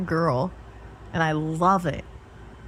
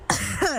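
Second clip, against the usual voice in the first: cough; 9 semitones higher than usual. Real, not acted. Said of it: "I'm choking on water".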